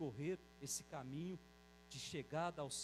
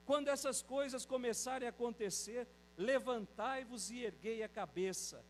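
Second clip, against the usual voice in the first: higher, louder call to lift your heads; proclaiming scripture; 6 semitones higher, 6 dB louder than usual. Acted. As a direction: proclaim the Gospel verse as a rousing call. Quotes the Gospel, raised voice. (proclamação do Evangelho, mais alta e mais forte)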